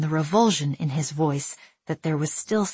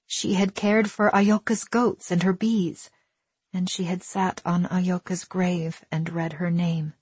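ordinary delivery

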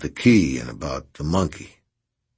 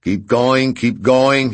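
loud, high bellowed order; bellowing; 7 semitones higher, 7 dB louder, slower than usual. Acted. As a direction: bellow over the thunder. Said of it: "he bellowed".

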